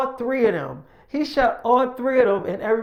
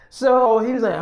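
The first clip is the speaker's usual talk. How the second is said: ordinary talk, nothing marked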